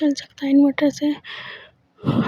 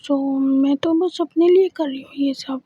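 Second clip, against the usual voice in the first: plain reading voice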